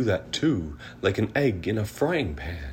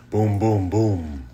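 loud booming thunder; 7 dB louder, slower than usual. A narrator's reading. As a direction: boom like thunder. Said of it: "Mister Brown makes thunder".

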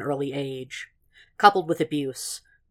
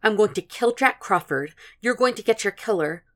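exasperated vow; 6 semitones higher than usual. A narrator's reading. Exasperated character repeats her vow.

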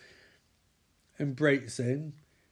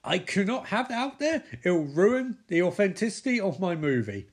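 ranting diva; 7 semitones higher, 5 dB louder than usual. Retold or imitated. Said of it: "Lawrence Fishburne's like".

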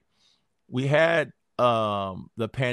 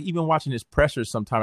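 ordinary delivery

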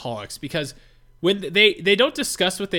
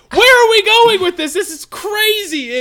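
much higher and louder incredulous outburst; shocked disbelief; 14 semitones higher, 9 dB louder than usual. Retold or imitated. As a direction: react as if the conversation just went off a cliff. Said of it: "I was like".

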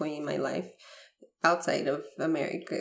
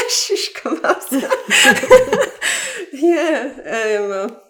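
loud high laughing fit; real laughter spilling over words; burst of laughter; 8 semitones higher, 13 dB louder, slower than usual. Real, not acted. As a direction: cracks up helplessly. Laughing fit after emotional story.

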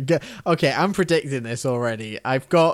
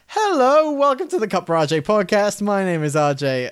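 higher, louder mock podcast host greeting; mock show opener, pitched up at the start; podcast host intro; 6 semitones higher, 4 dB louder than usual. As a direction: open the show like a mocking host. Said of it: "start off doing the podcast thing".

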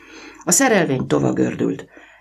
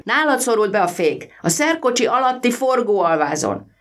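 put-on boy's voice; 11 semitones higher than usual. A narrator's reading. Boy explains to driver, voiced.

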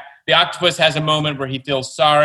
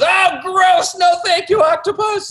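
very high, louder squeal of disgust; disgusted boy; 4 dB louder, slower than usual. Acted. Boy refuses egg-salad bath, high squealing disgust. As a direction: shriek it like a grossed-out kid. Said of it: "And the boy says".

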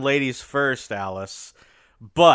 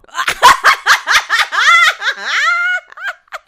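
loud belly laugh; 10 dB louder than usual. Real, not acted. Bursts out laughing.

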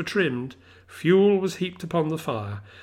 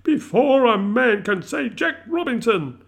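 jovial boss's high, loud cry; hearty, rousing order; Fezziwig; 9 semitones higher, 6 dB louder than usual. A narrator's reading. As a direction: hurry the lads along merrily at a shout. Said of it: "cried old Fezziwig".

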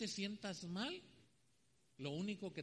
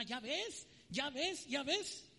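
triumphant gloating; 7 semitones higher, much slower than usual. Retold or imitated. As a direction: rub it in with glee. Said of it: "le dijo a la vecina". (la señora presume ante la vecina, aguda y fuerte)

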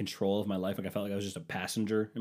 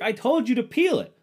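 much higher and louder, mock wife; wife snapping back; 14 semitones higher, 9 dB louder than usual. Wife insisting she said to peel it, high and loud. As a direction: fire it back in her voice. Retold argument, wife's line.

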